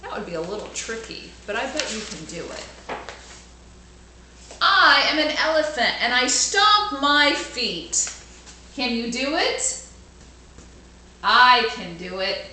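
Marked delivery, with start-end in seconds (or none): 4.6-12.5 put-on bright, louder storybook reading for kids; lively read-aloud. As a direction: read it out big for the class.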